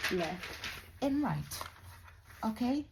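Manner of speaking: ordinary delivery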